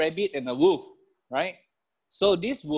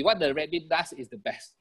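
ordinary delivery

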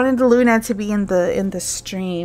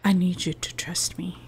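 low, hushed echo of a game hero; mimicking whisper; 4 semitones lower, 6 dB quieter than usual. Echoes game character's line.

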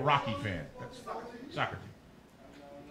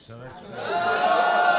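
squeaky punchline; 6 dB louder, much slower than usual. Acted.

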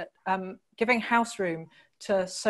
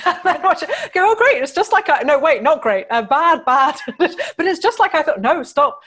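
laughing high and loud through the words; real giggling disbelief, barely getting words out; 8 semitones higher, 9 dB louder than usual. Real, not acted. Author laughing at her novel coming true, laughing hard.

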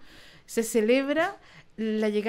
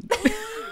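high burst of laughter; 11 semitones higher than usual. Real, not acted. Laughs after own punchline.

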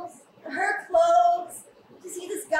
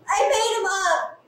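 normal talking voice

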